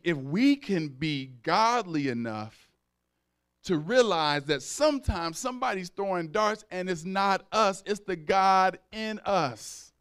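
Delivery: usual speaking voice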